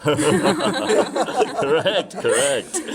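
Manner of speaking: laughing out the words, bright and loud. Acted. Old man laughing along with friends' gossip joke. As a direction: chuckle it out, agreeing heartily.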